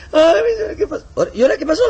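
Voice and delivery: very high, loud sing-song sigh; exasperated falsetto. Acted. Missionary greets the crying brother again, high exasperated falsetto. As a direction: ham up a weary woman.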